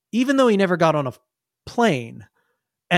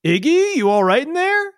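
high, raised calling voice; checking parent; 9 semitones higher, 3 dB louder than usual. Acted. Parent outside the room checking on Iggy, high calling voice. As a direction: call through the door, pitched high. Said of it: "a voice and says".

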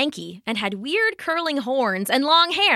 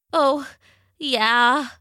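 sniffling; 3 semitones higher than usual. Acted. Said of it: "it sniffled".

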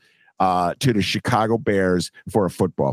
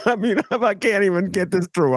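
laughing through the words, pitched higher; can't hold back the laughter; 9 semitones higher than usual. Real, not acted. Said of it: "I'm laughing".

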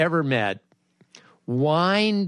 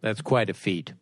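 deep mock praise; 7 semitones lower, 4 dB quieter than usual. Host answering the guest with a mock-solemn pronouncement. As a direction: play it weighty and solemn.

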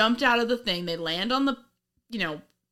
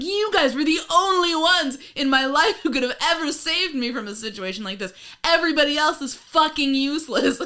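higher, pointed mock-grateful speech; hammed-up pointed gratitude, louder at both ends; passive aggressive; 7 semitones higher, 4 dB louder than usual. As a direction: praise some so that it stings the others. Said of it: "passive aggressive".

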